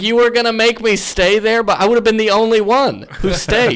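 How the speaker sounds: high-pitched retold indignation; indignant protest. Telling the hosts about being left in the green room, indignant.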